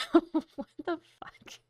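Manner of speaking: high, slow laughing disbelief; incredulous laughter. Real, not acted. Streamer cracking up at a meme replay, incredulous laughter. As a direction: laugh your way through the question.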